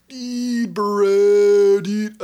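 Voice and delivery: high, loud goofy dude voice; Josh voice. Acted. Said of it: "that's more of my Josh voice".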